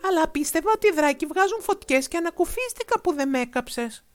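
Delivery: put-on relieved boy calling out; boy's voice. A narrator's reading. Boy explaining to the dragon, high and relieved. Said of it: "φώναξε".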